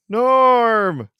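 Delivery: loud, high bar crowd shout of Norm; Norm callout. Pretend. Co-host shouting Norm like the Cheers crowd. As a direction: greet him like the whole Cheers bar.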